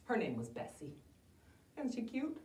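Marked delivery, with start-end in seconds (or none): none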